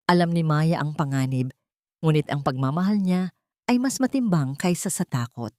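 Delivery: usual speaking voice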